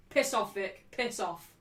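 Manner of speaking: ordinary talk, nothing marked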